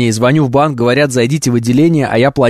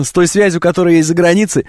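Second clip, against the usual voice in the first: normal talking voice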